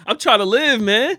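high, laughing protest; real laughter. Real, not acted. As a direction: talk through helpless laughter. Cracking up at the story.

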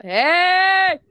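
a sudden, high, loud "Hey!"; loud demo shout. Shown as a demonstration. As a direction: shout it out to test the level. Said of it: "It's like saying how loud".

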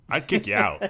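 high, loud bar-bouncer threat; mock bouncer shout. Bouncer scolding a creep, mock shouting. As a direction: throw him out, loud and high.

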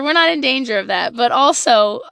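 ordinary talk

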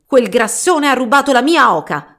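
loud, high, furious market woman; shrieking accusation. Acted. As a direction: screech it at the thief. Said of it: "strillò una".

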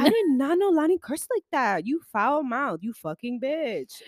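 playful shock. Retold or imitated. Teasing Lonnie about her swearing, high playful shock. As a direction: tease her like she broke a rule. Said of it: "I was like".

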